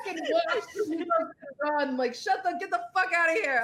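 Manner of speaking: retold angry rant. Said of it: "I was so mad".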